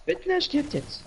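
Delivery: high mocking imitation. Mimicking friend's line high.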